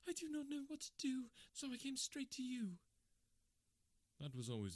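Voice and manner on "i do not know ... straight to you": much higher, tearful woman's voice; crying woman. Acted. Kate sobbing on her friend's shoulder, high tearful voice. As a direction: sob it out as Kate in distress.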